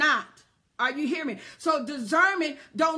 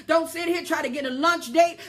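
ordinary talk, nothing marked

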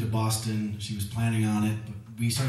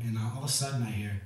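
normal talking voice

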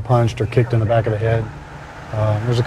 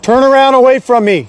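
loud, high-pitched command; real, forceful shout; shouted police order; 12 semitones higher, 6 dB louder than usual. Real, not acted. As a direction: yell it at a suspect across the yard. Officer orders suspect to turn.

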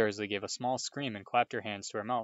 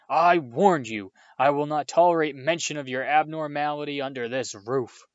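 furious shout; 4 semitones higher, 8 dB louder than usual. Acted. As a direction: bellow the final warning. Uncle's furious climax.

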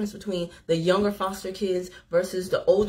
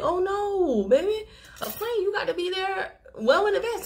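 higher motherly correction; 10 semitones higher than usual. Retold or imitated. Telling the young man planes need early arrival, motherly and high. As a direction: gently set him straight, pitched up.

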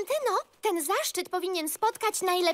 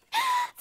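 excited gasp. Acted. Excited by the message task.

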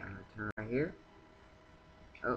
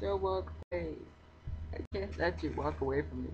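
pleading; 7 semitones higher than usual. Real, not acted. Pleading with villager.

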